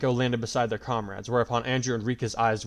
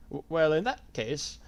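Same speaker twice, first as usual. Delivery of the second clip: put-on nervous old man; 5 semitones higher, slower than usual. A narrator's reading. Frightened old man speaks.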